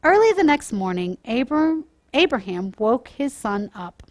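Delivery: put-on storytime voice for children, lively and clear; gentle storyteller reading to kids; story narration. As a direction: read it like a bedtime Bible story.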